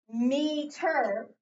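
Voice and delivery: "meter" slowly, as two drawn-out syllables; "meter" pulled apart to show the syllable break; split into syllables. Shown as a demonstration. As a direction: stretch "meter" into me and ter. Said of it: "Am I dividing here".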